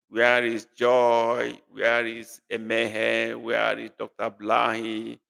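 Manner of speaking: "Where is" drawn out slowly; slow roll call. Real, not acted. Teacher slowly calling out for absent students. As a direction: call each name slowly. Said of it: "roll call of missing students".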